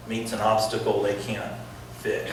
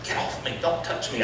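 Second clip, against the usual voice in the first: hushed warning; 7 dB quieter than usual. Retold or imitated. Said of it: "She's like".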